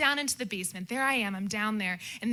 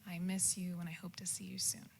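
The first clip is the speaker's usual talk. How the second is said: tender whisper; 3 semitones lower, 8 dB quieter than usual. Real, not acted. Tender goodbye to family.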